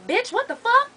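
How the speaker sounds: high disbelieving "what the fuck"; incredulous outrage. Retold or imitated. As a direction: squeal it in disbelief. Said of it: "And I'm like".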